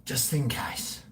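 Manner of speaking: hushed conspiratorial whisper; secretive whispered aside. Pretend. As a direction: let it slip out in a breath. Whispered aside about the Coke.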